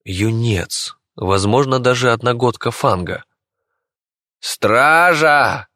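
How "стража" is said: high, urgent call to the guards; raised, commanding yell; emperor's shout. A narrator's reading. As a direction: shout a summons across the hall. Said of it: "закричал император".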